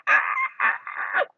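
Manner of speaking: short, shrieking storybook scream; high-pitched scream. A narrator's reading. Goldilocks waking to three bears, high scream. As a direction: scream in sudden fright. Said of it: "she screamed".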